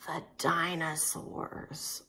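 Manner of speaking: hushed whisper so the dinosaurs don't wake. Shown as a demonstration. Teacher whispering to the kids about the dinosaurs. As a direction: breathe the words out softly. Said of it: "Uh-oh".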